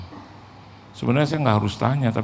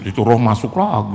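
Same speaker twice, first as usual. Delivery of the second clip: normal talking voice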